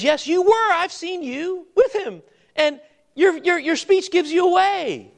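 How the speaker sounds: put-on high, accusing voice of the girl by the fire; servant girl accusing. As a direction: point the finger at Peter, pitched high. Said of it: "And she says".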